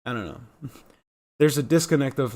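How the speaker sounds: ordinary talk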